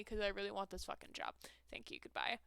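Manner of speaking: soft whispered aside. Real, not acted. Asking chat to wish her luck on the job, whispered. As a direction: whisper it like a secret.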